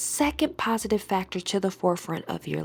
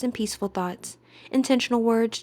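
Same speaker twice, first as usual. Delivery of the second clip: ordinary talk, nothing marked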